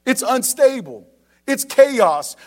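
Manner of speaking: rising shout. Acted. Preacher warning parents about a chaotic home, loud. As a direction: build to a near shout.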